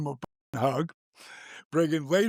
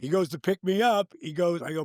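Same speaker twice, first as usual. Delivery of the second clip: ordinary talk, nothing marked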